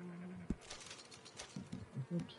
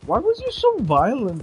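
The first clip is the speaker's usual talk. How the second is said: high, loud mock indignation; hammed-up outrage, pitched high and loud; mock scolding; 9 semitones higher, 5 dB louder than usual. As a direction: scold him like he offended you personally. Mock scolding a game character.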